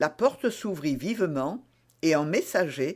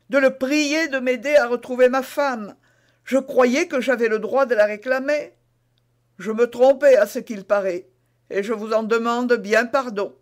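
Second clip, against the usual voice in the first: anxious, slightly raised suspect's voice; Bonacieux contrit; 8 semitones higher, 7 dB louder than usual. Acted. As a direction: justify yourself, then beg pardon. (suspect qui s'excuse, voix un peu haute)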